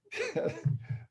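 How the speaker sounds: high-pitched chuckle; short, high laugh after a joke; amused high giggle. Real, not acted. Laughs after own acted outburst.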